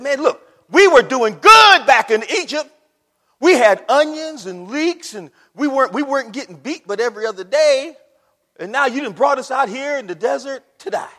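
loud, high-pitched grumbling protest; loud mock outrage; acted indignant complaint. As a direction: play the aggrieved crowd complaining to Moses. Voicing Israelites' complaint to Moses.

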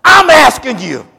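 high, loud, and slow desperate plea to God; shouted plea. Acted. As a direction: cry out to God begging for the child's life. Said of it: "He said".

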